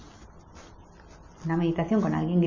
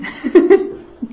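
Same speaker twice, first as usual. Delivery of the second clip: a short real laugh, higher and louder; bright laugh; 8 semitones higher, 10 dB louder than usual. Real, not acted. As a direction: laugh at your own joke. Laugh after a joke.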